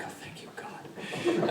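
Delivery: slow, breath-only whisper with a laugh in it; whispered relief. Retold or imitated. Therapist privately thanking God, whispered relief.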